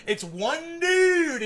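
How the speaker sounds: "dude" very drawn out, high and loud; stress on DUDE. Retold or imitated. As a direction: stretch "dude" in exasperated disbelief. Hammers the single source again.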